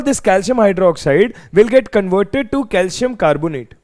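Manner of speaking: normal talking voice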